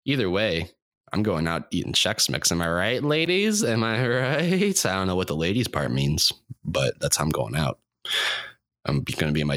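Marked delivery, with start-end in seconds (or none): 2.5-4.9 hammy comic catchphrase. Acted. Host mocking himself, hammed-up cheesy comic voice. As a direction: sell it like a cheesy club comic.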